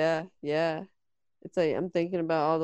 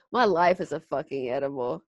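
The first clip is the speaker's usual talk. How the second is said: usual speaking voice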